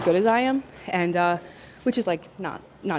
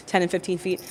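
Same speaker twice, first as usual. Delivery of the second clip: ordinary talk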